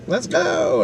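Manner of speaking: high, thin distant call. Retold or imitated. Mom calling her kid from the creek, calling out.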